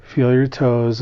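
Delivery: usual speaking voice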